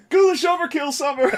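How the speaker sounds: high, loud laughing quote of the catchphrase. Real, not acted. Friend gleefully quoting a show line at the others, laughing high. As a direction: squeal the catchphrase through laughter.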